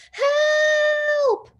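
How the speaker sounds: high, loud cry for help; desperate shout. A narrator's reading. Trapped Watsons scream for help, desperate. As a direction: scream it for rescue.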